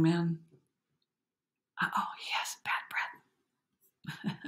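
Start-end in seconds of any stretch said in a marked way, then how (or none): 1.8-4.5 whispering through a laugh; giggly whisper. Real, not acted. Narrator whisper-giggling about the boy's bad breath. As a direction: share the joke quietly.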